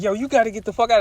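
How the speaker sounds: high-pitched, urgent kick-out; worked-up retold demand; retold exasperated order. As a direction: act out ordering someone out, pitch way up. Acted throwing her out of house.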